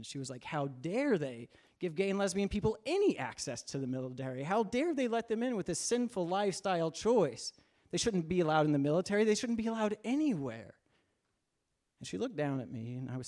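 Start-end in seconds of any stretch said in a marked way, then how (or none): 0.4-10.7 indignant retold tirade, climbing higher; angry mother. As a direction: build from outrage to a high, emphatic finish. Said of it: "And she was angry".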